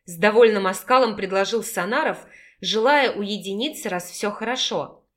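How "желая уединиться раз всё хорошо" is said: ordinary delivery, nothing marked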